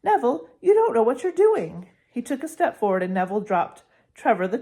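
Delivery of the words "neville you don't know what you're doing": heated warning. Acted. Ron confronting Neville.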